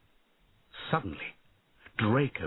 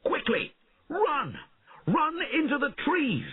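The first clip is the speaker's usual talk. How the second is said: urgent shout. A narrator's reading. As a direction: hurry them into the woods. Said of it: "shouted to the peasants".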